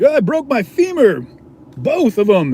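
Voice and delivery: high, loud hammed-up agony; exaggerated high cry of pain; mock pained wail. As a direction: wail like an injured drunk. Imitates injured drunk person.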